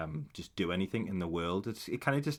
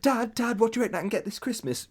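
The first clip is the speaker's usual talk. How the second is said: put-on much higher and louder, fast eager kid; child voice; 12 semitones higher, 4 dB louder than usual. Mocking a soap kid asking dad for presents, high eager child voice. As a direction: pester like an excited child. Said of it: "Merry Christmas".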